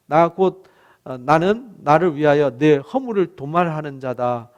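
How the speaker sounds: ordinary talk, nothing marked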